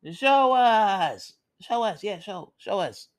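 high mocking crowd voice; taunting crowd. Villagers jeer at Viho, mocking and high.

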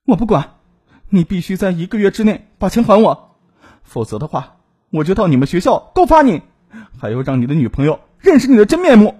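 high, loud, insistent ultimatum; angry threat. A narrator's reading. Woman demands her money back from ex, angry threat. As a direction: threaten him hard, raising pitch and volume.

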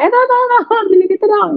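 high-pitched frantic "tolong, tolong" in a rush; a flustered mum hurrying her child to fetch something; imitating a rushed mother's frantic request; panicky mom. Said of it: "emak-emak lagi panik kan, buru-buru".

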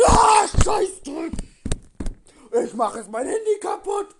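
raging yell, loud and high; furious shout. Real, not acted. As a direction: scream in gaming rage. Raging at game loss.

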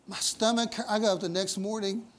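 ordinary talk, nothing marked

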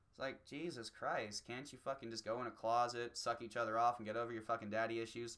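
ordinary talk, nothing marked

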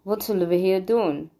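usual speaking voice

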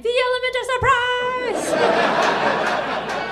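high, loud gleeful battle cry; high loud gloating shout with a laugh; triumphant yell. Retold or imitated. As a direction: yell it as you throw the punch. Punchline shouted while punching friend.

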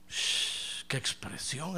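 normal talking voice

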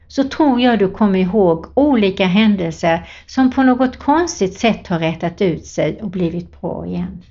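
ordinary talk

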